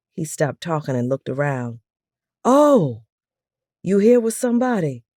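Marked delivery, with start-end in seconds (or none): 2.4-5.0 surprised. A narrator's reading. Noticing she's not alone.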